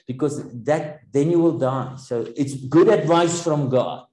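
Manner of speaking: ordinary talk, nothing marked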